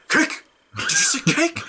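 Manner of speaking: sharp high startled question; panicked mock double-take; alarmed surprise. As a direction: jolt at the word cake. Character hears "pound cake.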